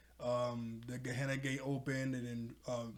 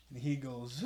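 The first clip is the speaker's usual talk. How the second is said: ordinary talk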